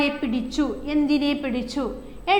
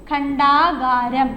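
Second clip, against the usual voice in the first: ഖണ്ഡാകാരം said very slowly and louder; slow dictation; 5 dB louder, much slower than usual. Shown as a demonstration. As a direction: draw it out for the class. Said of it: "dictation of word". (പതുക്കെ ഉറക്കെ)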